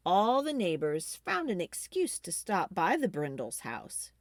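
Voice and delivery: ordinary delivery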